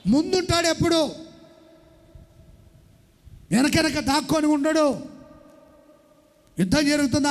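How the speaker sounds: preacher building to a climax about the true king; fervent preaching. Acted. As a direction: drive the point home to the crowd. High, forceful sermon climax.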